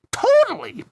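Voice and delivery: put-on very high girly squeal; high, eager cartoon agreement; Petunia. A narrator's reading. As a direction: gush it up high like a cartoon girl.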